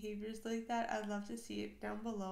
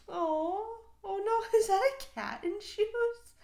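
higher, much louder laughing squeal of surprise; delighted shriek; 10 semitones higher, 9 dB louder than usual. Real, not acted. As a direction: shriek with delight at the funny cat. Delighted shock at cat video.

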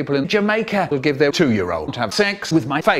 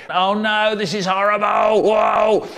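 higher, shouted mock panic; panicked shout; 4 semitones higher than usual. Boy after his penis explodes, hammed-up shout. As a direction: yell it out in cartoon horror.

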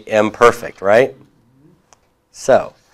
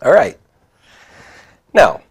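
ordinary delivery

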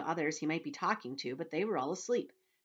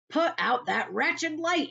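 high, loud, slow shout; 9 semitones higher, 7 dB louder, slower than usual. Acted. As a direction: bellow it while hurling a boot. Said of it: "he shouted".

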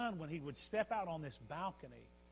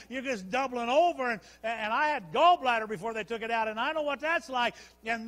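loud, high pulpit testimony; raised, driving sermon voice; full-force preaching; 7 semitones higher, 13 dB louder than usual. Acted. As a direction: preach the painful memory with force.

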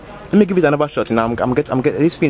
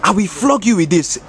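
mock anger; 5 semitones higher, slower than usual. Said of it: "This guy is making me angry".

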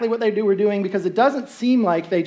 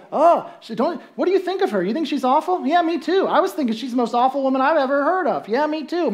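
catty gossip; 6 semitones higher than usual. Acted. Townsfolk judging Gomer together.

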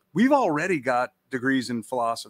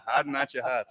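put-on Boston accent; 4 dB quieter than usual. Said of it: "the reader was from Boston".

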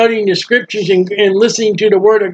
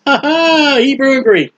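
laugh; 6 semitones higher than usual. Real, not acted. Preacher laughs at Hebrew and Greek.

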